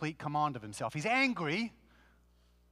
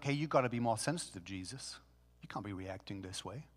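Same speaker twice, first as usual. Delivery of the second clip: soft, lower-pitched mock scolding; mock gentle reproach; 7 semitones lower, 7 dB quieter than usual. People calling out Jesus for his anger, mock gentle reproach. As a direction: chide quietly, trailing down softer.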